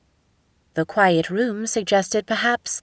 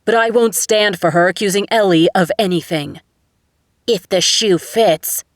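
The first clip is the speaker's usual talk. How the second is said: angry shout; 5 dB louder than usual. A narrator's reading. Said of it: "Stephen shot back".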